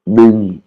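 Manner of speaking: "Nung" said with a clear falling tone. Shown as a demonstration. Teacher demonstrating the falling tone on "Nung". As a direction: let "Nung" drop downward. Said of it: "une intonation qui descend".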